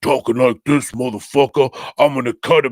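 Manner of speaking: imitating the movie's CGI elf threatening; mock tough-guy elf. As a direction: swagger like a foul-mouthed gangster elf. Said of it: "he just talks like this".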